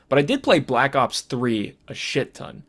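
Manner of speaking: normal talking voice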